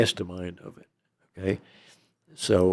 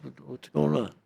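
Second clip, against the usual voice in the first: higher, softer puzzled question; puzzled protest; 5 semitones higher, 6 dB quieter than usual. Retold or imitated. Inmate asks the raiding agents what is happening, puzzled. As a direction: act out a taken-aback question.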